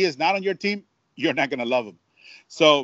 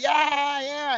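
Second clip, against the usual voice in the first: imitated yelling; 10 semitones higher than usual. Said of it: "yelling, screaming".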